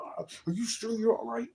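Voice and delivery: high, falsely concerned cop voice; mock police officer. Mocking the officers who came to his door, high false concern. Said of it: "police officers show up at my door".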